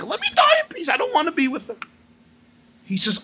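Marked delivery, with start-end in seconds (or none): 0.0-1.8 high-pitched, fed-up pleading; exasperated plea. Acted. Acted father begging to divorce.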